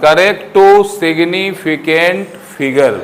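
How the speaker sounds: slow, words spaced out; slow dictation. Shown as a demonstration. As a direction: dictate it slowly for the notes. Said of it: "अब लिखोगे". (धीरे-धीरे बोलकर लिखवाना)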